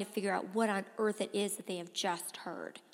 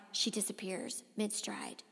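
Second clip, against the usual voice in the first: soft narration fading to a whisper; hushed ending; 7 dB quieter than usual. A narrator's reading. Boy seeing Jamie vanish in a flash, hushed. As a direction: drop away softly, slowing to a whisper.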